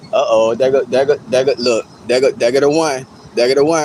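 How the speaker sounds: low-pitched voice of people pointing someone out; mock onlookers. Streamer acting out everybody looking at the mad chatter, low mock alarm. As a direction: play the onlookers, dropping low. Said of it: "Everybody looking at you like".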